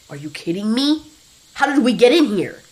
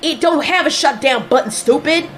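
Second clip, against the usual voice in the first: loud, raised yell; yelling back; 6 semitones higher, 5 dB louder than usual. Acted. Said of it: "yelled back Leo".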